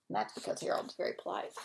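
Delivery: fast, low whispered comment; whispered aside. Real, not acted. Reader's aside to the kids, fast whisper. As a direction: toss it off under your breath.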